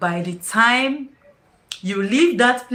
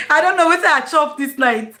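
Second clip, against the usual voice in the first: ordinary talk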